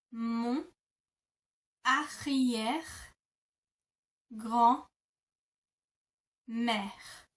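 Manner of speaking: mon arrière-grand-mère stretched very slowly; very slow teaching demo. Shown as a demonstration. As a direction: drag out every syllable. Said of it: "you would say".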